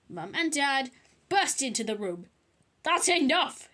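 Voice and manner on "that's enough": loud, higher parental shout. A narrator's reading. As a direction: shout to stop the fight. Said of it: "shouted Mum".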